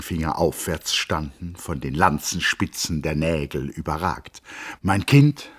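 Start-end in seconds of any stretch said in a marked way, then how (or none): 4.8-5.5 higher and louder fond address to the dwarf; a hint of grand tenderness, rising; Peeperkorn addressing the girl. A narrator's reading. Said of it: "sagte er". (leicht erhoben und lauter: „Mein Kind“ an die Zwergin)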